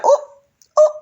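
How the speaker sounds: very high-pitched "ooh"; two high little hoots of mock shock; mock surprised oohs. Viewer reacting to the age claim, mock surprised oohs.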